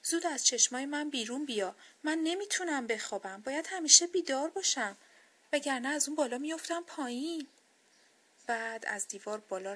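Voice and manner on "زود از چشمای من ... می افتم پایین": higher, louder upset protest. A narrator's reading. Hurt rabbit ordering Sleep out of its eyes, upset. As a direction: plead crossly in a raised, higher voice. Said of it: "با ناراحتی به خواب گفت".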